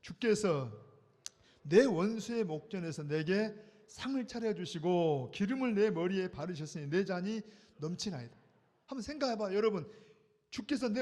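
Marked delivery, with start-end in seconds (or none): 0.0-8.4 slow, loud reading of the psalm verse; reading scripture aloud. Shown as a demonstration. Pastor leading the congregation in a loud scripture reading. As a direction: read it out together, slowly. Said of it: "시작".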